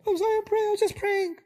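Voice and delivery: nervous, apologetic whine. Shown as a demonstration. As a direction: shrink back and say sorry for praying. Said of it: "'cause you're apologizing".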